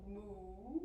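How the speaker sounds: low, soft moo; cow moo. Shown as a demonstration. Teacher cueing children into cow pose, soft low moo. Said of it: "drop your belly for cow".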